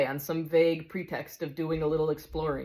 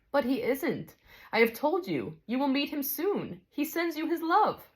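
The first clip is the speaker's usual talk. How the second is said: a touch higher, calm and kindly; gentle bright spirit; 10 semitones higher than usual. Acted. Bright spirit calmly answers the big ghost, kindly.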